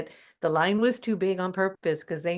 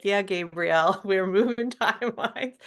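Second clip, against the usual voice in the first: laughing. Retold or imitated.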